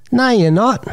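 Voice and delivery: put-on high, flat-out contradicting kid; boy calling the bird-watching bluff, high and cheeky. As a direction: play the smart-mouthed boy. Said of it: "And he says".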